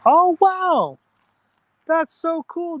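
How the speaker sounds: sudden high, loud burst of delight; excited squeal. Real, not acted. Streamer hears a viewer's shout-out news, squealing with excitement.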